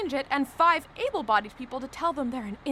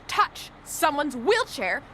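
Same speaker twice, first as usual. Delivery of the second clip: slow word-by-word reprimand; emphatic, punched words with pauses; stern scolding; slower than usual. Acted. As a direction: spell it out angrily, slowly. Scolding the man who touched chair.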